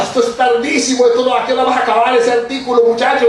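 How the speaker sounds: high-pitched impatient scolding; imitated yelling. Colleague hurrying the young reporter along, high-pitched yelling. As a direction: mimic a colleague yelling about a late article. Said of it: "Olguita Emiliani gritaba".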